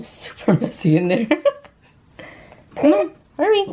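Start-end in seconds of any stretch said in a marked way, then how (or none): 2.7-3.6 high excited urging. Real, not acted. Urging the child.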